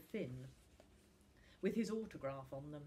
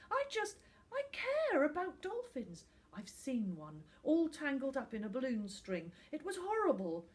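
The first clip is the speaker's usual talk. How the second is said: put-on upset boy; 8 semitones higher, 6 dB louder than usual. A narrator's reading. Finn upset.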